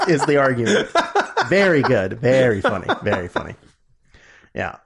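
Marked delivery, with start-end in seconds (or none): none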